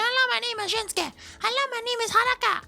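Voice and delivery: put-on extremely high squeaky kid voices; imitated other kids. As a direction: squeak out copycat classroom introductions. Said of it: "And then the other kid's like".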